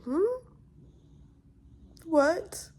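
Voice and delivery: high incredulous what; stunned disbelief. Retold or imitated. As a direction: react like you can't believe your ears. Disbelieving reaction to partner.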